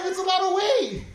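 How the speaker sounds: ordinary delivery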